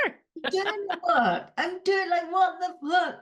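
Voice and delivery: high, slow, emphatic repeat; exasperated. Real, not acted. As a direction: stress doing, drawn out. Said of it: "repeats, more exasperated".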